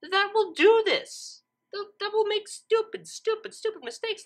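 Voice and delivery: cringing reader ranting about math mistakes; exasperated rant. Real, not acted. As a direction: wince out the words. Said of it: "but my God, it still makes me cringe".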